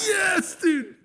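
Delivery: high, crying shout of joy; cheering yes. Acted. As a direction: yell it like rejoicing troops. Soldiers cheer for Napoleon.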